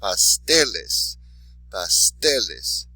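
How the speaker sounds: "Pasteles" slowly, TE stressed and loud; slow teacher's "pasteles", punching the middle syllable; stress on next-to-last syllable. Shown as a demonstration. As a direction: model "pasteles" twice, leaning on TE. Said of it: "listen to the word".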